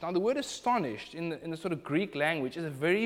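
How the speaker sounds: ordinary delivery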